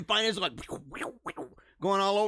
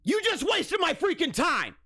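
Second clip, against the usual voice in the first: higher pitched, exasperated outburst; exasperated rant; 8 semitones higher than usual. Acted. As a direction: vent the wasted time in a strained high voice. Ranting at TikToker's fake apology.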